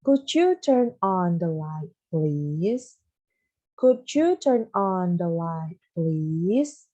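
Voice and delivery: Could you turn on the light read smoothly and slowly; slow, smooth full-sentence model. Shown as a demonstration. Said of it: "ini Miss kasih tahu ya".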